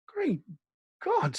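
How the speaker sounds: high strained cry of horror; Lydgate, aghast at the confession, crying out in horror; groan of horror. Acted. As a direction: gasp it out horrified, pitch flying up. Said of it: "in a groan of horror".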